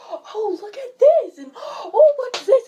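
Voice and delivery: high, loud, slow gushing over things; nosy excitement. Retold or imitated. New guest in the bedroom gushing over stuff, high and loud.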